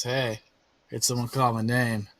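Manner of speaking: low, soft-spoken sleazy pest; Prospero, sleazy schemer. Acted. Schemer butting in on two companions, low sleazy voice. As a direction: sidle in with a low, quiet voice. Game master plays Prospero.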